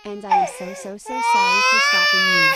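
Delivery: high, loud, sobbing sorry; crying apology. Pretend. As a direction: wail the apology. Doll apologizes tearfully.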